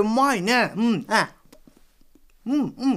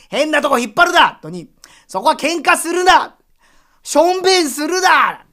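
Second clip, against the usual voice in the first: loud, higher angry yelling at the crowd on his head; 怒鳴る; 5 semitones higher, 6 dB louder than usual. Acted. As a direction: snap at the revellers up there. 頭の上の花見客を怒鳴る. (頭の上の客に大声で怒鳴る)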